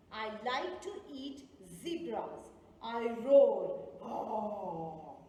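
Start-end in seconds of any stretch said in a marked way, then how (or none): 2.8-3.9 a loud, low roared word; playful loud lion roar for kids. Acted. Okay, I'm going to repeat it again. 4.0-5.2 a loud, low roared word; playful loud lion roar for kids. Acted. Riddle about a lion.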